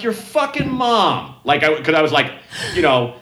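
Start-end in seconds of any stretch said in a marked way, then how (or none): 0.0-1.3 mock comeback. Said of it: "I was just like".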